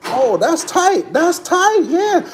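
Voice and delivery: high, drawn-out admiring exclamation; excited delight. Real, not acted. Admiring car parts.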